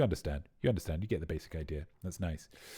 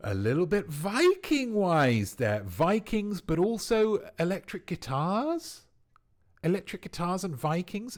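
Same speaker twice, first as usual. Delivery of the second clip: excited; 9 semitones higher, 4 dB louder than usual. Real, not acted. Excited reaction to viking name.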